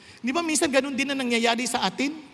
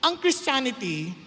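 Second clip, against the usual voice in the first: fervent sermon delivery; full-force preaching; 7 semitones higher than usual. Acted. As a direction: preach it out to the congregation.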